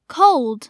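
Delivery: "cold" with a dark, blurred L before the d. Shown as a demonstration. Teacher giving learners an example word, dark L in "cold". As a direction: say "cold" as a model, darkening the L. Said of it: "L音要读成模糊舌边音".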